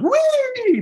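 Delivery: put-on high squealing child's whee; a child's joyful whee on the swing; gleeful whee. Daughter swinging down the trapeze.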